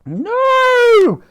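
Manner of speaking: very high, loud anguished scream; long shrieked no. A narrator's reading. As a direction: cry out in horror. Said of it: "screamed Dottie".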